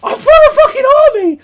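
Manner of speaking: very high, loud hyped-up yell; hyped shout. Retold or imitated. Fired up by the chanting crowd, hyped shout. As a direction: explode with excitement. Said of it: "I'm like".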